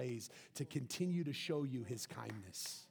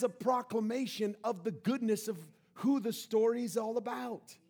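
loud, raised sermon delivery; preaching at full force; 8 semitones higher, 7 dB louder than usual. Acted. Preacher proclaiming God's goodness to the congregation, at full force. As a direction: drive each line home to the room.

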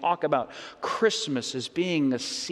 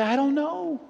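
squeaky high helpless voice; high confused whimper; 12 semitones higher, 4 dB louder than usual. Retold or imitated. Pastor mimics a crying person who doesn't know why. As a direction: play the tearful one who can't explain. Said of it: "And you say".

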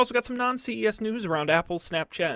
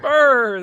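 cold shiver sound; shivering brr; 6 semitones higher, 9 dB louder than usual. Pretend. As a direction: brr like freezing. Echoing the cold joke.

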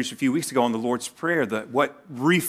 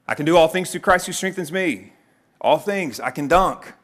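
higher, louder overconfident declaration; cocky, raised-voice misquoting; mock triumphant boast; 5 semitones higher, 4 dB louder than usual. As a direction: proclaim the verse like a hyped-up athlete. Overconfident misreading of verse, acted.

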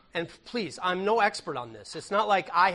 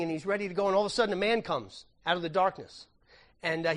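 usual speaking voice

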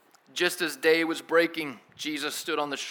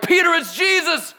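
very high, loud cry; full-out high-pitched holler; John's excited shout; 12 dB louder than usual. Retold or imitated. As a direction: yell the discovery to a friend. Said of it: "And then John's like".

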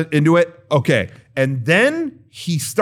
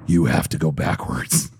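much lower, much softer attendant; a parking guy leading a driver to a special spot, low and hushed; low, quiet attendant voice; 14 semitones lower, 5 dB quieter than usual. Retold or imitated. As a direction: drop the voice low and hushed like a shady guide.

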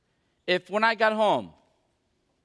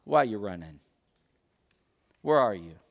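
low, soft questions with space between; quiet, much lower pleading; gentle appeal; 12 semitones lower, 3 dB quieter than usual. Acted. God asks the runaway man, low and gentle. God's real meaning, voiced.

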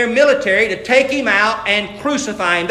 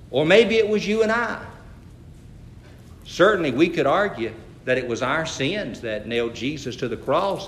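normal talking voice

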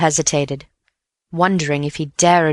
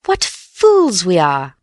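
slow, louder gleeful exclamation; triumphant; 3 semitones higher, 4 dB louder, much slower than usual. A narrator's reading. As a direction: laugh at your own blindness with glee. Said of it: "cried Bill triumphantly".

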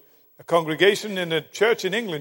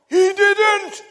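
put-on high, loud outraged little boy; shouted out; 14 semitones higher, 9 dB louder than usual. Little boy to the preacher from his seat, outraged shout. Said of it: "shouted out".

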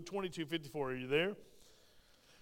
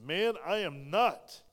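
slow denial; 2 semitones higher, 5 dB louder, much slower than usual. Acted. Peter's denial read aloud.

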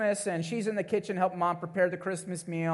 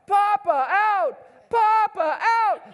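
put-on very high, louder child's wail; toddler crying out; 6 dB louder, much slower than usual. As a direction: call for grandpa like a desperate baby. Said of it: "he just starts crying out".

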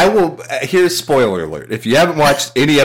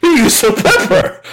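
high, loud and laughing through it; mock gushing, laughing; 10 semitones higher, 4 dB louder, slower than usual. Mocking a judge gushing over Bobby Flay's pepper, laughing. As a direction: gush about the pepper while cracking up.

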